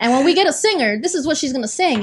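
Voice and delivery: retold rundown for the bandmates; brisk retold instructions. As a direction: keep briskly directing the band, voice lifted.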